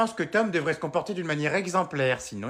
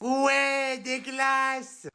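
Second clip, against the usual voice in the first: put-on high, bright child cheering for ice cream; excited child voice; 9 semitones higher, much slower than usual. Kids cheering when mom brings home ice cream, high excited voice. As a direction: squeal it like a happy kid.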